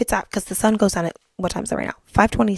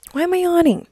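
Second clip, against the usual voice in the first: surprised yawn; 10 semitones higher than usual. Real, not acted.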